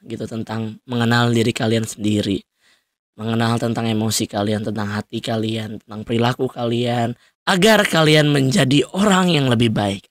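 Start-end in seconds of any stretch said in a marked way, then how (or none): none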